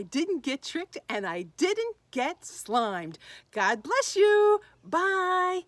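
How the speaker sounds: high, loud, drawn-out goodbye; gleeful, loud sign-off; exuberant farewell. Real, not acted. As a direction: sing out a big goodbye.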